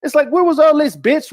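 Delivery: normal talking voice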